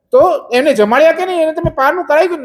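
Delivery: high, fast worried demand from the king; urgent king. Acted. King anxiously questions the guards.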